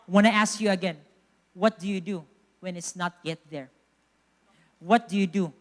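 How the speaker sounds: ordinary talk, nothing marked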